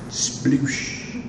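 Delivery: whispered splash noise of a man dropping into water; unvoiced sploosh of someone sinking; splash sound. Shown as a demonstration. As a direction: breathe out a soft sploosh. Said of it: "what the sound would have been".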